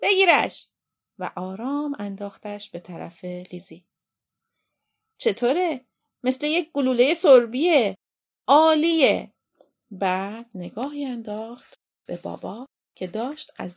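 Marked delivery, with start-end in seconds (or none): 0.0-0.7 sudden high, loud call; shout. A narrator's reading. Aunt tossing dough to Lizzie, shouting. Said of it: "داد زد". 5.2-9.3 higher, louder, exclaiming; pleased excitement. Acted. Aunt showing her dough to Lizzie, pleased and excited.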